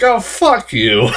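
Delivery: laughing, slowed mock curse; amused, drawn-out brush-off; laughing retort. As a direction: laugh while telling the movie off. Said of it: "I'm like".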